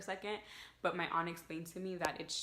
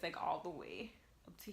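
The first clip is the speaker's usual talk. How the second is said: whispered; 2 semitones lower, 6 dB quieter than usual. Real, not acted. Aside while showing hair.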